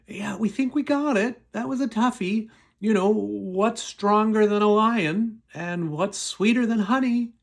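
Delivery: high, gloating mock voice; wedding guests smugly handing Samson his riddle's answer, high and gloating.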